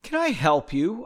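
usual speaking voice

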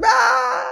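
high yelled ah fading away; flying scream. Shown as a demonstration. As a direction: cry out as if launched into the air. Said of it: "pretty good voice acting".

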